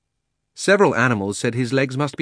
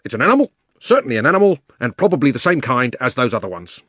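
bulldog voice; 3 semitones higher, 6 dB louder, faster than usual. A narrator's reading. Bulldog declares its finding after sniffing, loud and insistent. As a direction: burst out quickly and loudly as a bulldog. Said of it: "The bulldog".

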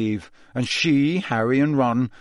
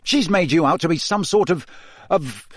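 exasperated telling-off, raised in pitch; urgent scolding hiss; 6 semitones higher than usual. A narrator's reading. Ron rebukes Hermione over Rita Skeeter, exasperated hiss. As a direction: scold a friend, then grope for the word. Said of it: "Ron hissed at Hermione".

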